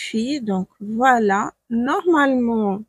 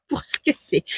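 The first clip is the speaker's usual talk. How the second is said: whispered aside; 4 semitones higher, 4 dB quieter than usual. Real, not acted. Aveu chuchoté sur le prix.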